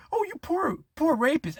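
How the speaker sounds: high, cooing mock sympathy; mocking pity. Mocking the pitying women, high cooing voice. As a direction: coo it in a silly high voice.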